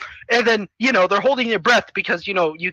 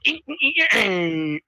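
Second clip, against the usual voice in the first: imitated robot noise. Robot hand shut in the door, imitated whine. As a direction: make squeaky repeated grunts, winding down.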